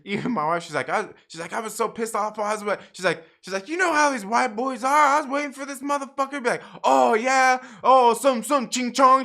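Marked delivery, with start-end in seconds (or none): 1.5-2.8 higher voice, imitating worked-up indignation; retold pissed-off wife. Wife venting to husband afterwards, imitated anger. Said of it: "she's so pissed off". 3.7-9.2 high, loud, mock-indignant rant; retold pissed-off wife. Wife expecting a taunt, retold worked-up anger. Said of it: "she's so pissed off".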